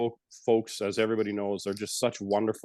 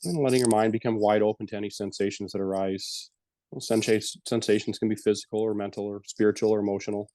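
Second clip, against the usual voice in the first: guided meditation. Acted. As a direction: ease the listeners gently back from the breath. Leads a closing meditation.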